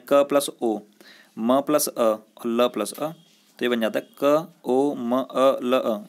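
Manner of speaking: very slow, each sound said apart; slow spelling out. Shown as a demonstration. Teacher splitting Komal into its letters slowly. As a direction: separate every letter for the class. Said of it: "कौन कौन सी ध्वनियाँ मुख से निकल रही हैं".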